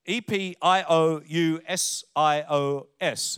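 slow letter-by-letter spelling; careful spelling for the congregation; spelled out slowly. Shown as a demonstration. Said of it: "It should be on the screen".

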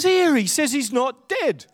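lightly voiced English cart collector; a hint of a Python cart man; Eric Idle. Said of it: "Eric Idle says".

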